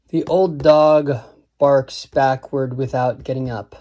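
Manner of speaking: put-on drawn-out "old dog", pause before "barks backward"; measured verse reading with heavy opening beats; slow, weighted line. Shown as a demonstration. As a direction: recite it deliberately, lingering on the first stresses. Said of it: "the first line is difficult to say fast".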